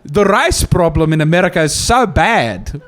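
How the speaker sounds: slow, lightly put-on South African accent; lightly lampoon Trevor Noah's South African accent; mock Trevor Noah. Comic mimicking a host's lecture, slow mild South African accent. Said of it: "my least favorite is Trevor Noah".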